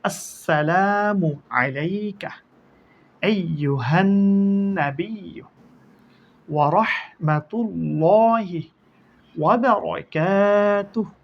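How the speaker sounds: আসসালামু and রাহমাতুল্লাহি drawn out slowly; slow recitation. Shown as a demonstration. Teacher reciting the greeting line for students to learn, slow. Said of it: "আবার বলি".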